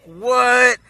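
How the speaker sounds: high, loud, drawn-out "what"; mock-outraged reaction to a suggestion; indignant disbelief. Beerus balking at the plan.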